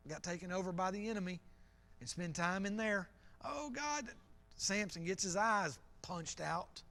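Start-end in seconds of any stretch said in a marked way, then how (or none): 3.4-4.1 pleading cry. Acted. Acts Israel crying out when captive.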